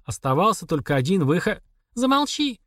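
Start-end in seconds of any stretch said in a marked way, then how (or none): 1.9-2.6 sobbing. A narrator's reading. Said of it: "всхлипнула женщина".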